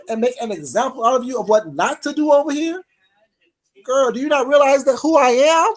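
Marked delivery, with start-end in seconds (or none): none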